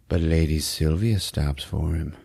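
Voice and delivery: usual speaking voice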